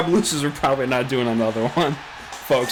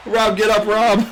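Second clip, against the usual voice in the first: excited cheering; 7 semitones higher, slower than usual. Real, not acted. Cheering for wrestler.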